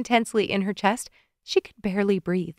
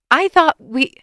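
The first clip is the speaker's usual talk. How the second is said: higher, loud voice cracking off; broken-off outburst; 7 semitones higher, 8 dB louder than usual. A narrator's reading. Woman breaks off mid-accusation, higher and loud. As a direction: burst out then cut yourself short. She breaks off, sucks breath.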